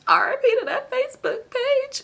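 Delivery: very high put-on falsetto wail; mock mourning. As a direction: squeal out a silly little memorial. Mock mourning a hacked page.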